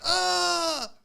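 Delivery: put-on a sudden high yelp as the kid's heart seizes; mock kid yelp. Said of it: "He's like".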